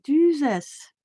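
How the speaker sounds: high exclaimed Jesus; retold disbelief. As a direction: blurt it out in disbelief. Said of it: "I was like".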